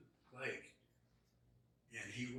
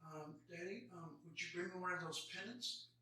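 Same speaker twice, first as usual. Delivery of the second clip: soft, high small-daughter voice; lightly voiced small girl asking her daddy; little girl voice; 6 semitones higher, 6 dB quieter than usual. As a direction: ask shyly like a sick child. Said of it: "She goes".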